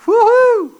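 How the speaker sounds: high, loud hammed-up whoop of glee; playing the disciples cheering at calling fire down; mock eager whoop. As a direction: whoop like a kid let loose to brawl. Disciples eager to burn village.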